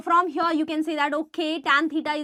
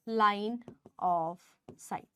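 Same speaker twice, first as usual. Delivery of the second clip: "Line of sight" slow, low and soft; slow soft repeat; 6 semitones lower, 9 dB quieter, much slower than usual. Shown as a demonstration. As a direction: drawl "Line of sight" quietly to stress the term. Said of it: "This here is the line of sight".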